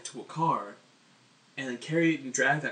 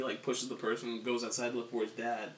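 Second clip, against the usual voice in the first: usual speaking voice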